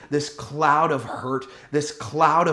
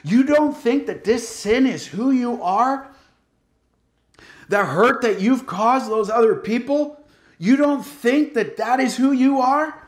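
devil's taunting voice; 9 semitones higher, 4 dB louder than usual. Retold or imitated. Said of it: "You ever hear that voice?".

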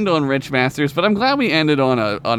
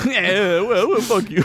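higher, mock-heated Jersey comeback; North Jersey accent; 5 semitones higher, slower than usual. Co-hosts trading play insults, North Jersey accent. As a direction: fire back in a hammed-up Jersey accent. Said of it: "It's like North Jersey now".

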